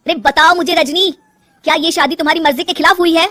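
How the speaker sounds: loud, high-pitched pressing questions; insistent demand. Acted. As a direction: press her urgently for an answer. Husband presses wife for an answer.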